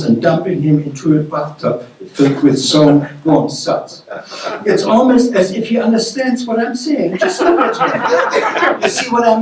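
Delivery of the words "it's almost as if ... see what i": amused fisherman. A narrator's reading. Fisherman showing his wife the odd creature, chuckling, higher voice. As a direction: marvel at the creature with a laugh.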